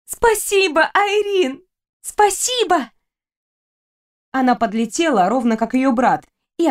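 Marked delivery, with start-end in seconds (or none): 0.1-0.9 high, bright joyful thanks; excited thanks. Acted. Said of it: "вспорхнула с кровати и закружилась". 0.9-1.6 high joyful call of her name; excited. Acted. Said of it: "вспорхнула с кровати и закружилась". 2.0-2.9 high, delighted repeat of thanks; even more excited thanks. Acted. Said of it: "вспорхнула с кровати и закружилась".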